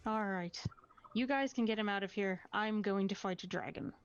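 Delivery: normal talking voice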